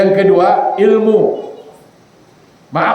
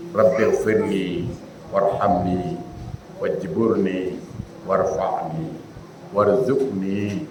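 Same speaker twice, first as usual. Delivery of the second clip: slow, soft, each word drawn out with proper length; slow careful recitation; 10 semitones lower, 10 dB quieter, much slower than usual. Shown as a demonstration. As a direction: recite it gently and unhurried, honoring every syllable. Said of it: "Soal cepat nggak masalah, tapi jangan langgar".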